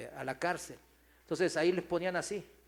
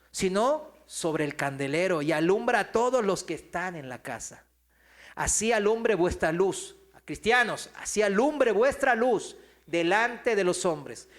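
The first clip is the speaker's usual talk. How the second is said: scripture reading; 3 semitones higher, 8 dB louder than usual. Acted. Reads scripture with force.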